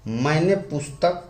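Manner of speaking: ordinary talk, nothing marked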